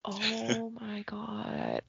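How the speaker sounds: hushed, drawn-out disbelief; aghast whisper. Real, not acted. Enraged host reacting to the article, aghast whisper. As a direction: breathe it out very softly and slowly.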